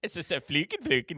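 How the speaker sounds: lightly voiced Swedish Chef gibberish; a hint of Muppet chef babble. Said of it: "It's the Swedish Chef".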